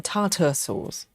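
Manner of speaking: tartar with a weak 'ter' ending; unfamiliar pronunciation. Retold or imitated. Host doubting the viewers' version, unfamiliar TAR-ter pronunciation of tartar. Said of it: "never heard people saying".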